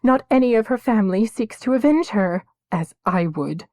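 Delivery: high, loud pleading prayer; girl praying for justice; desperate prayer. A narrator's reading. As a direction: plead with the gods.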